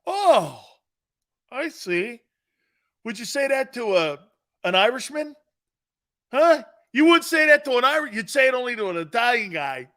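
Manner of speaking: mock indignation. Pretending to be insulted as an Italian, mock protest. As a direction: act insulted, pushing back hard.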